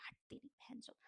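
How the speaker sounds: hushed swear under her breath; whispered abuse, cut off mid-word; whispered curse. Retold or imitated. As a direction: whisper the insult so nobody hears. What she'd say to their face.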